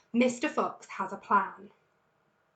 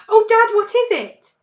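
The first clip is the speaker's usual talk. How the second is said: much higher, loud eager plea; excited cubs; 12 semitones higher, 15 dB louder than usual. A narrator's reading. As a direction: beg Dad to tell, bursting with hope. Cubs begging to hear the plan.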